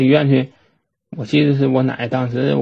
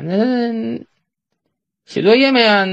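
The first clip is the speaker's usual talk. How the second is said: dad asking; 9 semitones higher, slower than usual. Retold or imitated. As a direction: imitate dad checking up. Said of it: "就问我奶呀".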